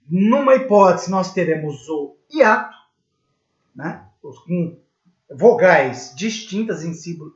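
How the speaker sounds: ordinary talk, nothing marked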